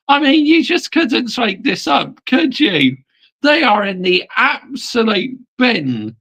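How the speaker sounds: high, emphatic incredulity; amused disbelief. Real, not acted. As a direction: ride out of the laugh, pitched high and scoffing. Scoffing after laughing at defeat.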